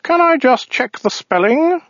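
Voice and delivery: high, slow, fussy alien; prissy high-pitched clerk voice; weaselly voice. Acted. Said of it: "said the alien".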